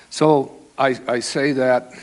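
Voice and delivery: normal talking voice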